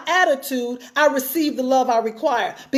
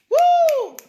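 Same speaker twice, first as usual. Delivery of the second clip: high, sustained "woo"; excited whoop. Real, not acted. Speaker cheering her own line to the audience, excited whoop. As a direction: whoop at your own point.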